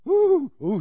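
high, loud alarmed ooh; startled crowd. Acted. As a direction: recoil at the sight of a leper's hand. Crowd reacts to leprous hand.

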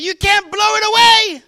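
very loud, high retort over the horn; imitated defiant shout. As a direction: holler it back, stretching the last word. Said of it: "And Brother Rogers said".

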